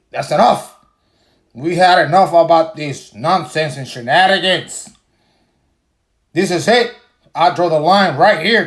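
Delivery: theatrical outrage about dropping the beer; mock fury. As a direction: play the fed-up drinker ready to burn his beer. Mock outraged rant again.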